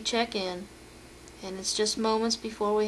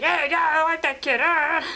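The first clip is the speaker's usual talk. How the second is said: higher, louder mock puppet cheer; 5 semitones higher, 3 dB louder than usual. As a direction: cheer the kid on like a rowdy puppet. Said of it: "It's like".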